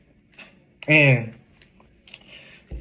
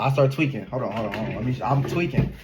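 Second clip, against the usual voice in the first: normal talking voice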